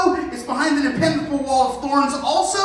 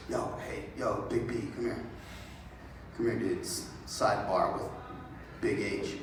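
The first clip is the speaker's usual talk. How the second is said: much lower, much softer aside; hushed sidebar; 14 semitones lower, 14 dB quieter than usual. Acted. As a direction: pull him close and murmur. Hrothgar pulls Beowulf aside secretly.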